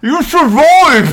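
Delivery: loud, high, very slow cheer; over-the-top triumphant doctor; mock doctor. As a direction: ham up the proud surgeon. Doctor voiced in joke.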